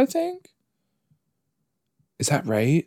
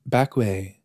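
ordinary delivery

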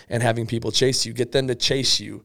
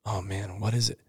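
much softer, lower worried customer; nervous customer; 4 semitones lower, 11 dB quieter than usual. Shown as a demonstration. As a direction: play the tense buyer bracing for it. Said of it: "you lean over and you build the momentum".

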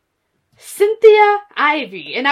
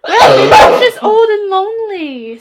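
put-on higher, louder old lady voice; mock old lady; 4 semitones higher, 6 dB louder than usual. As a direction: ham up a lonely grandma.

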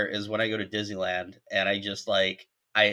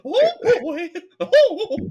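squeaky mouse voice; 8 dB louder, much slower than usual. Acted. Reacting to South Park scene.

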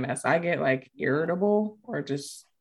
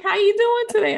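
high, loud mock-friendly greeting; chirpy greeter; 5 dB louder than usual. Someone greeting a sufferer, acted chirpy and high. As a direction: put on a high, chirpy hello.

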